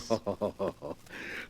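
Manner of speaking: low mocking laugh, breathy and soft; sinister laugh. Morlock mocks Jennings' guess about the police, low sinister laugh. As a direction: laugh off the servant's naive guess.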